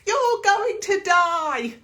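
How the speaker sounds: very high, loud and slow dramatic wail; mock doom wail. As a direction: drag it out like a panicking foot. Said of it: "my left foot sends messages".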